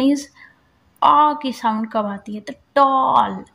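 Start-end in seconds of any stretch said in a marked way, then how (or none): 2.8-3.4 टॉल with a long rounded ऑ, slow; aw sound in tall. Shown as a demonstration. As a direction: draw out the ऑ in टॉल. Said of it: "ऐसे बोलते हैं". (टॉल में लंबा गोल ऑ)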